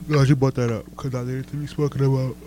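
talking through a yawn. Real, not acted. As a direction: yawn while speaking. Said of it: "Sorry for the yawn".

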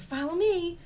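high, loud bossy mother hen; Mama Chicken voice. A narrator's reading. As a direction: call it out like a brisk mom waking her chick. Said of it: "She says".

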